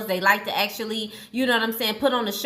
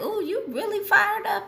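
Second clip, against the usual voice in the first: much higher, put-on voice for God; amused divine voice, high and light; voicing God; 11 semitones higher than usual. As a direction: play God teasing, pitched way up. God's reply voiced in a story.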